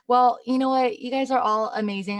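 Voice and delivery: normal talking voice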